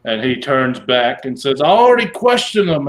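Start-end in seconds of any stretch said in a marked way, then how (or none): 1.6-2.9 higher, louder insistent guard. Acted. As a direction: defend the claim firmly. Charmed guard answers hobgoblin.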